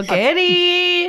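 calling a name loudly. Acted. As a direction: call out into the house. Calling out for friend.

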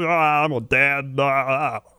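slow, drawn-out sitcom dad groan; Ray Romano. Retold or imitated. Sitcom dad turning down his pal, slow moaning impression. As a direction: groan the excuse as a put-upon dad. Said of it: "surprisingly accurate Ray M-, Romano".

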